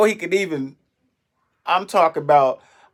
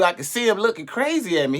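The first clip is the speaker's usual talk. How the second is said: ordinary talk, nothing marked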